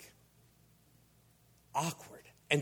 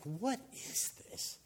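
hushed, drawn-out bewilderment, pitched up; baffled onlookers; 4 semitones higher, 10 dB quieter, slower than usual. Retold or imitated. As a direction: play the crowd murmuring in disbelief. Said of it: "everybody was like".

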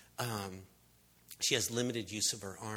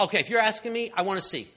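loud, raised, eager reply; eager answer; 8 semitones higher, 8 dB louder, much faster than usual. Acted. Blind man answers Jesus' question, loud and eager. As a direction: blurt out the request with energy.

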